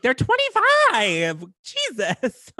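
high, drawn-out mock disbelief; exasperated retort. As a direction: stretch it out in exasperation.

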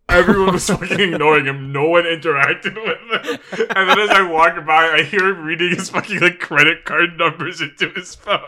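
talking through real laughter; laughing. Real, not acted. Telling co-host about the sign guy, laughing.

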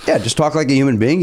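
ordinary talk

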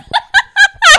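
high shrieking laugh; loud burst of laughter. Real, not acted. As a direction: crack up loudly. Laughs at friend's story.

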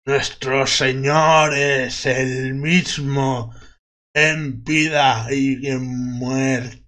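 very slow, solemn voice from the grave; undead voice. Acted. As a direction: intone it like a cold corpse. (voz lenta de ultratumba)

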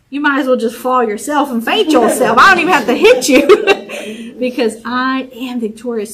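triumphant boast. Pretend. Boxing ring victory boast.

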